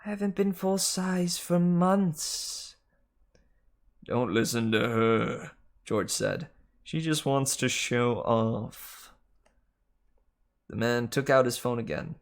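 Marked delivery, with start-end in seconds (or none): 0.0-2.8 higher, slow secretary snake; high slow female snake; Martha the snake. Acted. Martha pleading. 4.0-5.5 very slow, grumbling snake; slow sulky male snake voice; George the snake. Acted. 6.8-9.1 very slow, grumbling snake; slow sulky male snake voice; George the snake. Acted.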